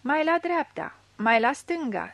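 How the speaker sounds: loud steering shouts; shouting directions. A narrator's reading. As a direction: call down from the bank. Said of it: "strigau ei de sus".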